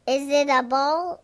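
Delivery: usual speaking voice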